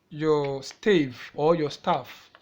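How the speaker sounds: slow, deliberate teacher naming of the terms; measured classroom pronunciation of stave and staff. Shown as a demonstration. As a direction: spell out both names slowly for the class. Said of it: "I'm teaching you how to draw your stave or staff".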